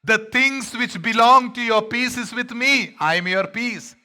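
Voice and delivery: loud, high, slow plea; Jesus pleading. Acted. Jesus to Jerusalem, loud high slow plea. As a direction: offer peace with a cry. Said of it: "and he's crying".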